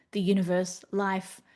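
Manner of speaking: ordinary talk, nothing marked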